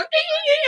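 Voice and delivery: very high puppy squeals; imitated dog yelping. Storyteller imitating thrown dogs, high-pitched yelps. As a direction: squeal like dogs hitting the wall. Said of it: "They're whelping".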